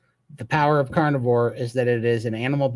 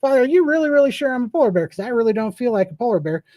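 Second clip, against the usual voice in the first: high, fast, worried little cub voice; baby polar bear; 10 semitones higher, 3 dB louder, faster than usual. Acted. Baby bear pleading with mom in a high, quick kid voice. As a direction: pipe it up like a nervous kid.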